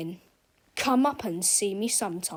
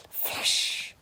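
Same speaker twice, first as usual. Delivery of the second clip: plain reading voice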